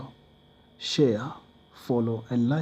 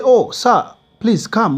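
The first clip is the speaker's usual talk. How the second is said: much higher, louder eager plea; eager invitation; 8 semitones higher, 7 dB louder, slower than usual. Retold or imitated. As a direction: urge him to come. Company calls back eagerly.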